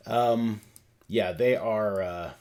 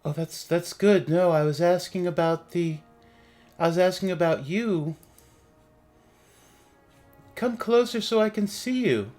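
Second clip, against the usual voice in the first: higher, lilting ghost voice; ghostly voice; 8 semitones higher, 5 dB louder than usual. Acted. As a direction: coax them closer like a spirit.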